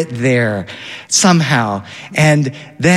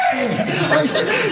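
high laughing burst; a giddy high laugh; real burst of laughter; 4 dB quieter than usual. Real, not acted. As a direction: let the laugh break out high and quiet. Laughing at son's whale shout.

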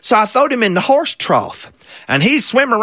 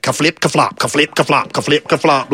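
imitated wet slapping steps; flopping sounds; 4 semitones lower than usual. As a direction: pat out a wet pancake waddle. Fish flopping behind him.

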